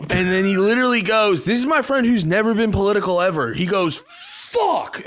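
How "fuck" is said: a sudden high, dismayed "fuck"; mock his horror at the news; high-pitched groan of dismay; dismayed curse. Friend reacts in dismay, retold.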